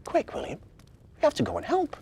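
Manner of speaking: put-on high child voice, urgent; worried little girl. A narrator's reading. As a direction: urge your brother to help. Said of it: "cried Victoria".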